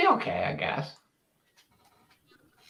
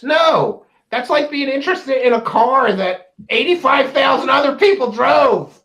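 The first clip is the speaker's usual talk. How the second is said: loud, higher, outraged protest; indignant shout; 5 semitones higher, 5 dB louder than usual. Real, not acted. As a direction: shout the denial in disgust. Indignant denial of interest.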